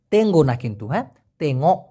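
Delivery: ordinary talk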